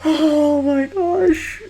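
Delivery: high, slow awed gush; swooning. Real, not acted. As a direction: melt over a crush. Swooning over the killers.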